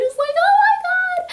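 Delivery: high, loud fangirl squeal of delight; excited squeal. Real, not acted. Fan gushing to viewers about a hug, squealing. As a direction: squeal it like you can't contain yourself.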